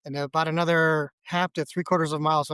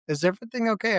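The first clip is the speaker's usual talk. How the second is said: lightly voiced wife, pitched higher; a hint of the wife's higher-pitched question; imitating wife; 6 semitones higher, faster than usual. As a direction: lightly mimic a wife checking in. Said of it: "Jennifer notices my hands, and she's like".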